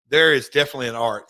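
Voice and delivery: "art" hit hard and loud, rushed phrasing around it; stress on art. Shown as a demonstration. Host stresses why great promos are art, "art" punched loud. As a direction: punch the word "art" to make the point to the listeners. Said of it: "I'm gonna stress the word art".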